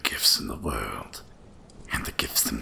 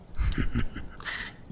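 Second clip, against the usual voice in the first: low chuckle. Acted. Dragon chuckles about the elves.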